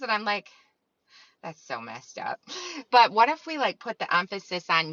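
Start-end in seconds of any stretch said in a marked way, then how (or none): 1.4-2.4 low, soft dismay. Retold or imitated. Retold reaction.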